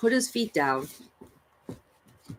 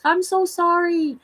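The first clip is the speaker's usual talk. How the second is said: tender apology; 12 semitones higher, 3 dB louder, slower than usual. Retold or imitated. Apologizing to the spider she flicked off, high and slow. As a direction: plead gently like you hurt a pet.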